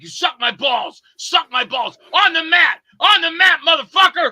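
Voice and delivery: high, loud, furious mimicked yell; mock angry shout. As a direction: bellow it like a furious tough guy on set. Retold on-set fight, guy yelling.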